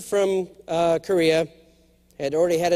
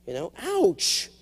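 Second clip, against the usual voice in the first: ordinary talk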